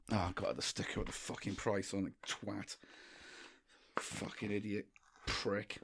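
swearing under the breath at himself; muttered swearing. Real, not acted. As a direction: grumble the insults almost to himself.

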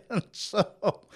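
laughing at his own nonchalance; real laugh. Real, not acted. Laughing while retelling the surgery to listeners. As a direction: chuckle through the words.

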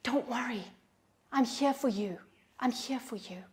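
soft, hushed comfort; whispering. Acted. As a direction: comfort the hurt, voice dropped near a whisper. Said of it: "He's whispering to the abused".

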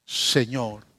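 ruego humilde, más bajo y callado; súplica suave. Acted. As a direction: suplica de rodillas, casi sin voz. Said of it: "le suplicó". (madre arrodillada ruega a Jesús en voz baja)

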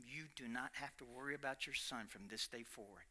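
soft, low, gentle assurance; calm reassurance. Retold or imitated. Stranger assuring a worried mother, soft and calm. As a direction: soothe her fears quietly. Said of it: "And he says".

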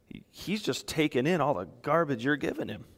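ordinary talk

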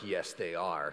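low, soft mock-stubborn reply; stubborn dreamer. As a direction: mimic the self-important dreamer. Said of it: "And they're like".

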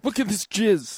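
squeaky indignant voice; shrill fake complaint; high mock outrage. Acting out proving to police.

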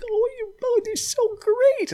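very high, slow gushing coo; mock gushing director. Mocking the director fawning over them, very high coo. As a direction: coo it like a doting fan. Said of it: "She's like".

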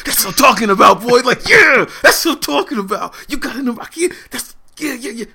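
high, loud hype cheer to his son; hyped dad cheering. Retold or imitated. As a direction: cheer him on like a proud dad. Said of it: "I'm like".

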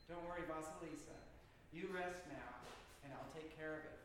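soft, quiet reassurance; tender spirit comforting the girl; soothing whisper. Acted. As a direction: hush her gently to sleep. Helper spirit soothes crying girl.

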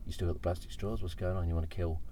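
usual speaking voice

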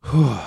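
big whooshing sigh; relieved exhale. Real, not acted. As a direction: blow out the tension. Venting breath after screaming.

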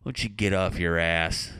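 slow, lightly voiced grumpy old man; hint of a cantankerous old grandpa; cranky grandpa. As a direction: insult the family as a crabby grandpa, drawing it out. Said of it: "I just wanna be a grandpa".

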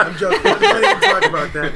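laughing it off. Real, not acted. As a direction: laugh through the words. Laughing while backtracking.

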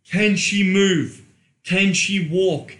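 slow and loud, hitting can; emphatic questions. Acted. Quizzing listeners after her orgasm, slow and loud. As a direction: lean on can, drawn out.

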